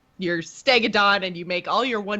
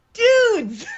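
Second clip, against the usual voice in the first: high, loud excited squeal; really excited; 7 dB louder than usual. Real, not acted. Player thrilled by a pile of recruit cards, excited outburst. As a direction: burst out with giddy excitement. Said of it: "I am so excited".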